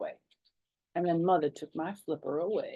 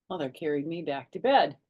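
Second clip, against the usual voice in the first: usual speaking voice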